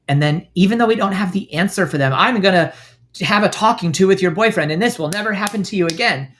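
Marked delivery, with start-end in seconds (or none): 2.1-6.3 mock protective bravado. Fixing friend's problem, mock.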